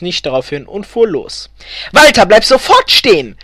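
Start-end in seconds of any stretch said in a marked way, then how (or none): 1.9-3.4 high, loud urgent scream; shouting. A narrator's reading. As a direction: shout a sharp command at your husband. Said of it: "schrie Elfriede".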